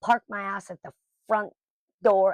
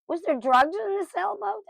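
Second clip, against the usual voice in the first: much higher, louder mock nosy question; nosy inmates; 13 semitones higher, 4 dB louder than usual. Inmates quizzing her about the sailboat, mock nosy voice. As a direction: mimic the gawking cellmates, pitched way up. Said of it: "they keep asking me".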